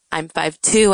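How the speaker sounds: ordinary talk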